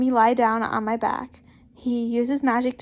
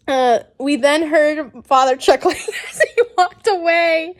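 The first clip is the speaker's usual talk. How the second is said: ordinary delivery, nothing marked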